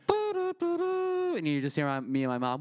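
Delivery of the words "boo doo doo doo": slow, very high hummed elevator tune; hummed hold music. Retold or imitated. IRS lady putting the call on hold, hummed elevator music. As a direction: croon it like cheesy hold music. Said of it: "She plays the elevator music".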